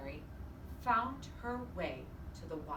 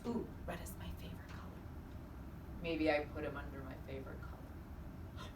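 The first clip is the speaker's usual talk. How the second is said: fast, hushed and secretive; playful whisper; 5 semitones lower, 8 dB quieter than usual. Real, not acted. Teacher teasing the children about the mouse, hushed. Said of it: "teasing game host".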